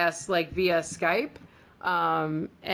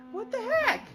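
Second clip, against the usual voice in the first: squeaky high what the heck; reacting to the letter, much higher, stunned; shocked disbelief. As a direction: leap up in pitch in disbelief. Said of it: "but she wrote it like".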